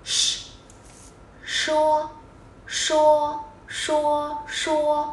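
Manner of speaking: "Sh" xì hơi uốn lưỡi; "sh" phụ âm cong lưỡi, không thanh; âm sh tiếng Trung. Shown as a demonstration. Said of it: "Âm này cực kỳ dùng nhiều nha". (phát âm "sh" kéo dài)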